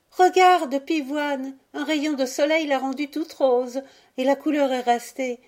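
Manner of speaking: put-on high, loud burst of childlike joy; joyful cry. A narrator's reading. Violette calling her brother to see the snow sister, joyfully. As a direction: call out happily to a playmate. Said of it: "Violette lui cria joyeusement".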